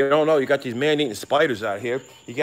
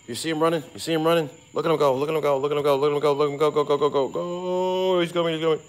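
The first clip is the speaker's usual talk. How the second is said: higher, louder excited chant; 5 semitones higher, 3 dB louder, faster than usual. Real, not acted. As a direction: rattle it off, cheering on the runaway. Excitedly watching a creature run off.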